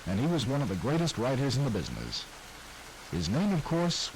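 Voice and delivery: ordinary talk, nothing marked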